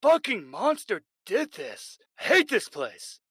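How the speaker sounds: high, loud furious rant; loud disgusted shouting; outraged yelling. Acted. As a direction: rage at the salad. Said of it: "looking at the potato salad and going".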